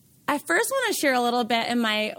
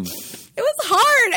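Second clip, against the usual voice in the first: tearful; 11 semitones higher, 5 dB louder, much slower than usual. Real, not acted. Tearful after recalling hard times.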